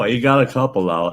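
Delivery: ordinary talk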